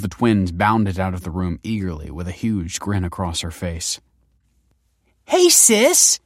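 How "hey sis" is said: very high, loud greeting; excited yell. A narrator's reading. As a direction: squeal hello to your big sister. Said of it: "yelled the first".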